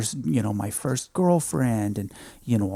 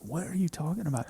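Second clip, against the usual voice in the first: soothing nurse; 5 semitones higher, 6 dB quieter, faster than usual. Retold or imitated. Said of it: "she's just like".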